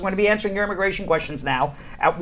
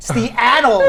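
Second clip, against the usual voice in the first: ordinary talk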